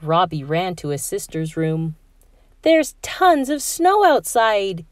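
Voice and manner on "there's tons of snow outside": put-on high, slow, thrilled boy; excited shout. A narrator's reading. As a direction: Robbie bouncing on his sister's bed, excited. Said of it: "he shouted".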